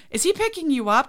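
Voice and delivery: high-pitched eager question after a gasp; gasp, then ask like an excited onlooker; mock excited household; excited gasping reaction. Acting out everybody's reaction to buzzer.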